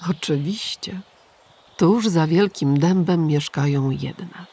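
usual speaking voice

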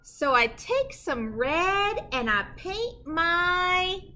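slow, drawn-out build to the rhyme; suspense pause. Acted. Reading to kids and pausing before the rhyme, drawn-out suspense. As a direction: leave them hanging before the rhyme.

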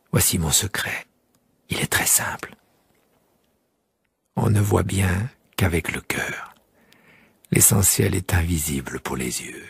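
low, hushed wise fox voice; fox's secret. Acted. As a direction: share the secret gently, low and close. Fox character speaking.

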